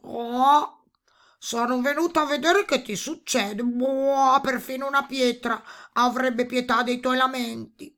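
high toad voice punctuated by croaks; curious toad. A narrator's reading. Toad come to see why the princess laments, croaky toad voice. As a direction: play a big toad, ungainly and loud.

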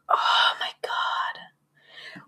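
slow, voiceless, tearful whisper; crying whisper. Retold or imitated. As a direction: let it crumble into a near-sob, very slow. Inner cursing after hurtful remark.

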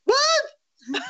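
high, cracking mock disbelief with a laugh; stunned disbelief. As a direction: play the friend who can't believe his ears. Partner stunned at enthusiastic reply.

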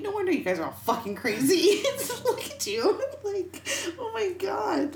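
laughing, high-pitched exasperated outburst; playfully appalled at the family, pitch shooting up; mock exasperation. Mock exclaiming at crazy family.

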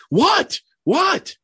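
high, loud panicked what, what; imitating his alarmed reaction to gossip; mock alarm. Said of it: "I'm like".

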